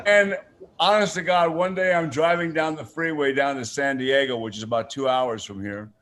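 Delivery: usual speaking voice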